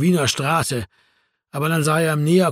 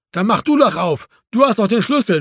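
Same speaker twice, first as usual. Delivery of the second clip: higher-pitched retort; calling back; 5 semitones higher, 3 dB louder than usual. A narrator's reading. Marco retorting to Erwin through the window, calling back. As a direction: argue back through the glass. Arguing through a closed door.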